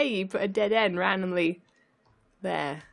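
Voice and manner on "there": usual speaking voice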